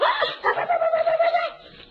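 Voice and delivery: loud high-pitched laugh; high burst of laughter. Real, not acted. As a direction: let out a big laugh. Laughs at child's moo.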